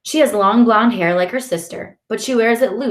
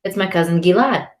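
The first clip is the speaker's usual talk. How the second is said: ordinary delivery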